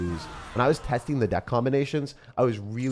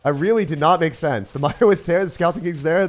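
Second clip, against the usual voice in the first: higher and louder, laughing; amused disbelief; 7 semitones higher, 7 dB louder than usual. Real, not acted. Streamer laughing in disbelief at his own unit, louder. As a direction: marvel at the silly play. Laughing at unit's odd choice.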